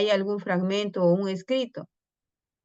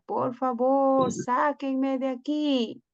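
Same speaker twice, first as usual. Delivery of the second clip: slow, higher pleading turtle voice; mock tearful cry for help; crying plea; 6 semitones higher, slower than usual. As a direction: beg to be rescued, slowly and tearfully. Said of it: "se puso a llorar y a llorar".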